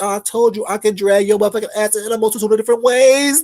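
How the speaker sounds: ordinary talk, nothing marked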